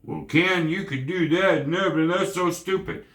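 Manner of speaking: high-pitched mocking put-down; mock critic. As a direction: put on a scornful know-it-all objection. Acts out a harsh critic.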